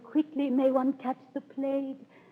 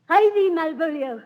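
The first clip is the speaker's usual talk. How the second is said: urgent command; 7 semitones higher, 7 dB louder than usual. Acted. Olivia sends Malvolio off urgently.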